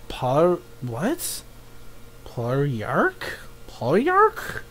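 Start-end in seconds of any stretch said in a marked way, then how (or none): 2.2-3.2 "Palyark" low and soft, rising at the end; puzzled reading. Real, not acted. Viewer puzzling over a title, low questioning "Palyark". 3.7-4.6 "Palyark" high and soft, rising; incredulous repeat. Real, not acted. Viewer trying a strange title, high questioning "Palyark". Tries the odd title higher.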